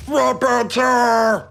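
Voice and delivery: very slow, loud, high bellowing shout; deep proud strongman bellow. Retold or imitated. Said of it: "a better Strong Mad impression".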